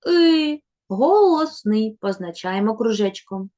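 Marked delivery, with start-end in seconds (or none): 0.1-2.0 «И» drawn out higher and louder; vowel sound. Shown as a demonstration. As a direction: model the vowel «И» slowly. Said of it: "Наступний звук". (протяжно «И»)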